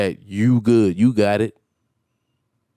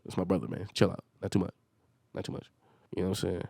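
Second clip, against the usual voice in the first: much softer, lower, under his breath; muttered aside; 6 semitones lower, 14 dB quieter, faster than usual. Real, not acted. Reminding himself it's his brother, muttered softly. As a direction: say it to yourself, backing off.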